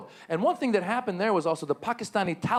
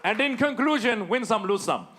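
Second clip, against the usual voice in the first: mock formal statement; 5 semitones higher than usual.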